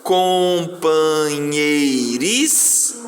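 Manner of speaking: COMPANHEIRIS very slowly as com, pa, nhei, ris; teacher slowly sounding out "Companheiris" syllable by syllable; piece by piece. Shown as a demonstration. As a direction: stretch every syllable of "Companheiris", holding the final s. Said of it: "vai falando pedaço por pedaço".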